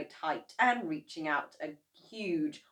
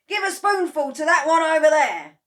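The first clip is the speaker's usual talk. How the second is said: high, loud command; eager father; 11 semitones higher, 15 dB louder than usual. A narrator's reading. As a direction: point and order it loudly. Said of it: "cried Mister Cranky".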